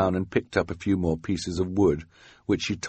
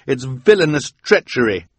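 higher, louder accusation; indignant cry; 7 semitones higher, 6 dB louder than usual. A narrator's reading. Quigley denouncing Esme's excuse, indignant cry. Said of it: "Quigley cried".

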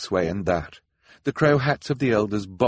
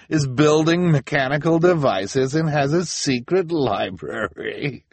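high, loud, drawn-out incredulity; scornful disbelief; 9 semitones higher, 6 dB louder than usual. A narrator's reading. Officer mocks the children's alibi before the crowd, scornful disbelief. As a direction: ridicule their story as absurd.

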